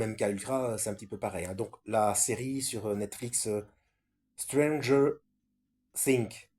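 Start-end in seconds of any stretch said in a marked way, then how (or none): 4.4-6.5 French speaker trying an English accent on "Stranger Things"; halting stab at English pronunciation; English accent attempt. Real, not acted. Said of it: "Excusez-moi pour mon accent anglais".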